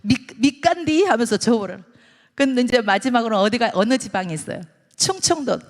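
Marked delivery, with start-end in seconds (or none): 0.0-1.0 drawn-out Jeolla grumble, higher; Jeolla dialect. Acted. Jeolla granny handing goods over at a loss, drawn-out dialect. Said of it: "전라도 말로".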